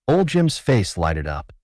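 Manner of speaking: plain reading voice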